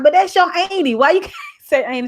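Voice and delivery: mock exasperation. Mother retelling her plea to her daughter, high mock exasperation. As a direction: plead in a high voice, breaking into a laugh.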